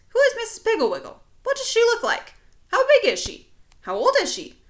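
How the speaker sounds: high, loud, rapid pestering questions; squeaky eager kid firing off questions; pestering child. Acted. Imagined child pestering the narrator with high questions.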